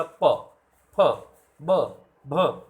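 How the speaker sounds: प फ ब भ said slowly and firmly, lips pressed on each; lip sounds demo. Shown as a demonstration. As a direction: sound out प फ ब भ one by one for the class. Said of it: "बोल के देखिएगा".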